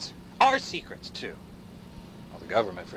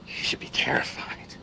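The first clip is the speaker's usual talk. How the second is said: whispered dread; creepy whisper; 4 dB quieter than usual. Acted. Warning listeners of machines, in a creepy whisper. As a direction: hiss the word terrified low.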